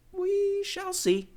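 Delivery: high, slow knowing reply; ominous wife. Acted. Wife answers her hopeful husband, slow high sly warning.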